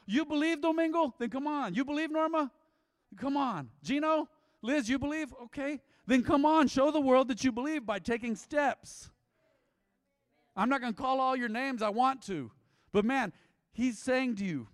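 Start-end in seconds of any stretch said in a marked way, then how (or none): 0.0-9.1 high, loud, rousing calls of come on; Jesus calling by name. Acted. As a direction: call each believer out onto the water. Jesus calls congregation members by name.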